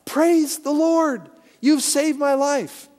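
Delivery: high, loud, slow joyful shout; cried out loud. Retold or imitated. Woman finds groceries on porch, slow loud joyful shout. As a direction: stretch out the praise in triumph. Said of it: "she cried out loud".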